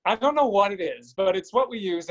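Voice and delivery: ordinary talk, nothing marked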